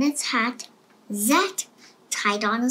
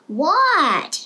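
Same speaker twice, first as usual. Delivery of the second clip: loud falling shout; child yelling back; yelled; 5 semitones higher, 6 dB louder than usual. A narrator's reading. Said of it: "yelled Froggy".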